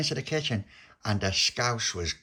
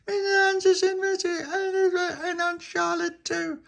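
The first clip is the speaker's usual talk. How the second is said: put-on very high, louder wailing child voice; crying girls telling the puppy what happened; 5 dB louder than usual. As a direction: wail the name like upset little girls. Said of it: "they were both crying".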